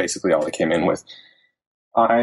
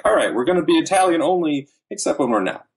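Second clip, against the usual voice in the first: mock Italian teacher; 8 semitones higher than usual. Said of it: "Italian strictness means, like".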